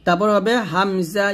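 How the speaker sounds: usual speaking voice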